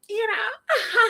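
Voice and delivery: high, put-on girly giggle; exaggerated high feminine laugh; cunty laugh. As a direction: titter daintily, affecting femininity.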